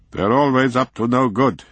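high, sour landlord grumble; grumbling. A narrator's reading. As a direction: complain with irritation. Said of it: "he grumbled".

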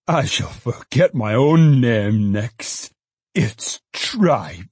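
slow, drawn-out exclamation; father's triumphant realisation. A narrator's reading. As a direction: announce the answer with relish. Father suddenly gets the answer.

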